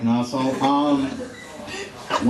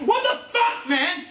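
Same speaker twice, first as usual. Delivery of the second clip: retold scream. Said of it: "Justin was screaming".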